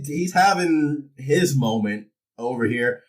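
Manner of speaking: ordinary delivery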